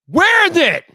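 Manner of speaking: high-pitched wailing demand; exasperated. Real, not acted. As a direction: cry out in frustrated disbelief. Resumes the exasperated rant.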